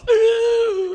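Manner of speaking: a high crying ooh; hammed-up creature wail; mock fish squeal. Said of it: "it goes".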